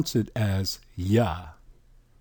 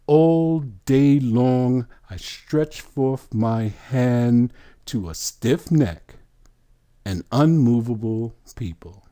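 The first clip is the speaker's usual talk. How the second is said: solemn scripture quote; slower than usual. Acted. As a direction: read the verse slowly and solemnly, stretching each word. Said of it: "What did he say?".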